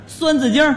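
孙子经儿 with a slurred first syllable and r ending; garbled pronunciation. Acted. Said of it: "你这嘴里有骨头是怎么着".

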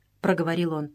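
plain reading voice

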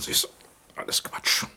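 whispered, waving it off; whispered dismissal. Acted. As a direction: breathe it out as nonsense. Old man dismisses his dream.

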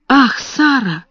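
high bright excited exclamation; excited cry. A narrator's reading. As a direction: burst out with a sudden idea. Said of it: "возбуждённо воскликнула она". (взволнованный возглас)

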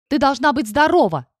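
insist, outraged; indignant. A narrator's reading. Dragon keeps arguing.

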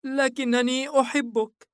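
put-on high, slow child's voice; small boy pleading with a weak sigh; forlorn little boy. A narrator's reading. Son confesses love to father, forlorn child voice. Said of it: "متنهدا بوهن".

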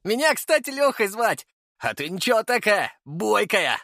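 high, merry character voice; cheery, flirty high voice; cheerful. A narrator's reading. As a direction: introduce yourself gleefully, pitched high. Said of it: "весело произнёс он".

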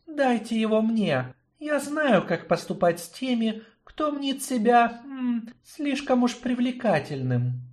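high, cold, sly woman's voice; cool and knowing, a little mocking. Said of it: "Ярина холодно улыбнулась".

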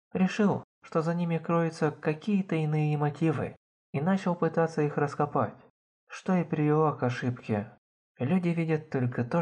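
usual speaking voice

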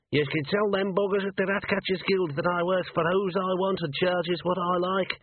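high, far-off gruff gnome voice, spat out between kicks; a tiny furious ratcatcher kicking a man down; gnome Arthur between kicks. A narrator's reading. Gnome lays into the guild enforcer, angry and high. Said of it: "he said between kicks".

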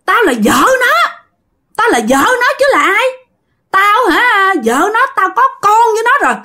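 high, wailing defiant spirit; possessing ghost wife. Acted. Ghost claims to be his wife, high and defiant. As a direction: claim him in a high, insistent wail. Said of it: "chú Tánh mới trả lời nè".

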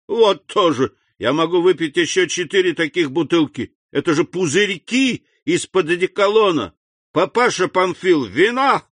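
put-on loud, high drunkard's bragging; blustering drunk Caderousse. A narrator's reading. Drunk Caderousse calling the innkeeper, boisterous. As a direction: boast tipsily, then holler for wine.

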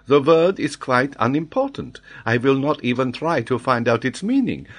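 put-on high, emphatic French-accented voice; excitable French geographer. Geographer explains his sudden flash to the Major, excited. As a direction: explain it loudly and eagerly, swooping in pitch.

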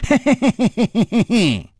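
high, long string of hee-hees; loud mocking laugh. A narrator's reading. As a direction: cackle boyishly at the absurdity. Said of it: "然后又大声笑着说".

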